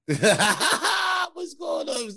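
high, very slow, laughing outcry; laughing slow cry. Real, not acted. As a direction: stretch it out through laughter. Laughing at shocking stream.

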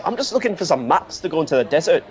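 normal talking voice

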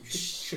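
soft unvoiced shush. Real, not acted. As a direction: shush them quietly. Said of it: "I'm shushing him".

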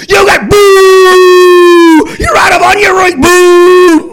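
very high, loud, drawn-out booing yell; mock booing shout. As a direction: boo the restaurant like an angry fan. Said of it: "could I have yelled and gone".

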